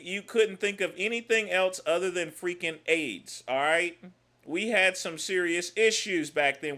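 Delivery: normal talking voice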